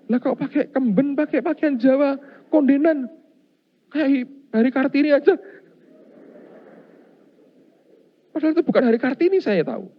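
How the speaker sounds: high, loud, mocking incredulity; preacher laughing at the ghost's Javanese outfit; amused disbelief. Real, not acted. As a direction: poke fun at the costume.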